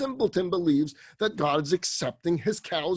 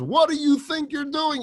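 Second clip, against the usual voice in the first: much higher, sharp confronting shout; caught-you shout; 11 semitones higher, 4 dB louder than usual. Acted. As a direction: catch him in the act. Rabbi jumps out at simpleton.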